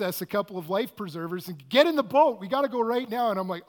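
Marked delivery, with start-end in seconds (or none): 1.7-3.3 loud, high, barked order; urgent shout. Retold or imitated. Boat guy shouting at Peter to board now, loud and urgent. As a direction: yell it like there's no time to lose.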